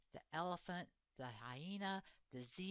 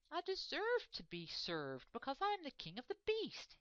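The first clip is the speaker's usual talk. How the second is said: a much higher, self-satisfied king's voice; pompous lion; 9 semitones higher than usual. Acted. Lion hiding in the grass reassures himself, pompous and high. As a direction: puff up like a spoiled king. Leo's proud thoughts.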